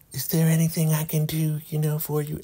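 nervous high put-on voice; Joan. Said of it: "she is so nervous".

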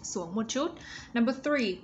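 usual speaking voice

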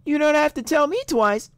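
very high cartoon reply; high character voice. Voicing a game character's reply.

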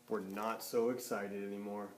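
narrating the chickens losing their excitement; deflated. A narrator's reading. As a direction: sink into disappointment. Chickens disappointed.

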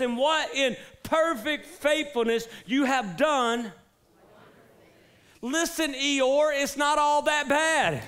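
high, loud, slow preaching cry; full-force preaching. Acted. Pastor urging the congregation to trust God, loud slow preaching. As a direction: preach it with fire, slowly.

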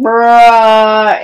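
loud, held-out mock protest; drawn-out bruh. Kid answering pizza guy's tip demand, drawn-out bruh. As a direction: stretch one word out loudly on a level pitch. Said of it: "and then I'm like".

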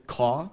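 "caw" with the R dropped and the vowel left forward; R dropped, vowel unshifted. Shown as a demonstration. Showing the class a New York sounding caw. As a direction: drop the R in "caw" but keep the vowel, New York style. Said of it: "just drop the R without backing".

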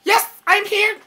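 high-pitched alien monotone; Conehead. Acted. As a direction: announce yourself in a high, stiff alien voice. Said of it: "I'm gonna do my Conehead impression".

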